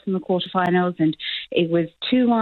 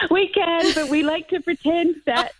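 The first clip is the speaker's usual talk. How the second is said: laughing; 10 semitones higher than usual. Real, not acted. Laughing while answering.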